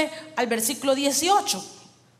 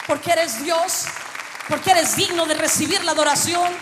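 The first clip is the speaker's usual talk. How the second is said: raised, declamatory preaching in separated bursts; prédica exclamada; 4 semitones higher than usual. Real, not acted. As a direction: proclaim worship to God phrase by phrase. Exclama su adoración predicando. (proclamación ferviente, voz alzada y pausada)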